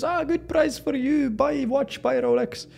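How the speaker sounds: put-on high, slow Thai street hawker accent; a street seller in Thailand pitching a Rolex, high and slow in a Thai accent. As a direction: hawk a fake watch like a Thai street vendor. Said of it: "the guy on the street just".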